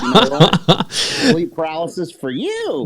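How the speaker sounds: high, loud, laughing witch curse. Pretend. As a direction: cackle out a curse. Voicing the witch Baba Yaga.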